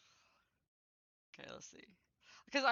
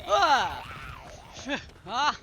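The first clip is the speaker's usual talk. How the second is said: startled yelp; 2 semitones higher, 10 dB louder than usual. Real, not acted. Startled by players.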